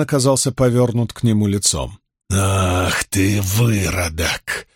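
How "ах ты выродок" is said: slow, sneering drawl; drawled insult. A narrator's reading. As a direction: stretch out the insult contemptuously. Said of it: "протянул дядя Хана".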